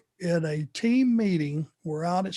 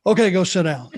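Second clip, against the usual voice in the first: louder, flat send-off; dismissive order; 6 dB louder than usual. Retold or imitated. Bill Watts dismissing him after the punch, mild bark. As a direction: wave him away offhand. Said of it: "And he goes".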